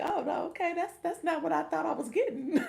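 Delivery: high voice breaking into laughs; laughing imitation. Retelling the opponent's confused reaction, laughing and high. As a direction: imitate her confusion while giggling. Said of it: "She was like".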